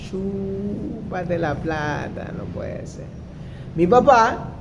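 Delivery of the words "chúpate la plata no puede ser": hushed, slow, to himself; whispered realization. Retold or imitated. Dawning on him after the bus fight, whispered to himself. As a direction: whisper it as the shame sinks in.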